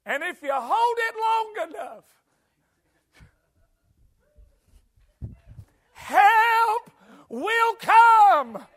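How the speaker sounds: high, loud, slow preacher's cry; preaching climax. Acted. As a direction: build to a ringing preached climax. Sermon climax, preacher rising.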